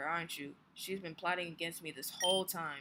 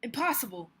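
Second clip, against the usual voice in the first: loud, raised protest of disbelief; disbelieving outcry; 7 semitones higher, 7 dB louder than usual. Acted. Beaten trainer crying out in loud disbelief. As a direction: cry out that it can't be. Opponent stunned by defeat.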